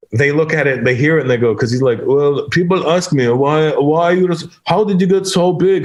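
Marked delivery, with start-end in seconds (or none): 2.0-5.8 put-on higher, thick foreign-accented English; earnest mock European accent; imitated foreign accent. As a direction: imitate a giant bodybuilder's accent. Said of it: "he has an accent".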